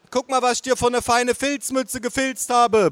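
high-pitched, doting motherly holler; bellowing mother. Acted. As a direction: holler it high across the table, pitch held up. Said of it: "brüllte meine Mutter".

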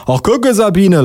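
high, mock-surprised policeman; acted gleeful policeman; laughing policeman. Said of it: "lacht der Polizist".

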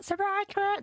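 high excited surprise call; a friend's surprise, called out high; mock surprise. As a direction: sing out the surprise.

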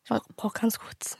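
sniffling. Real, not acted. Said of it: "ich wollte nicht heulen".

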